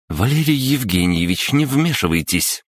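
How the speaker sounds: a touch of a shout; sharp reprimand. A narrator's reading. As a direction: snap at the father to stay out of it. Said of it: "тоже прикрикнула женщина".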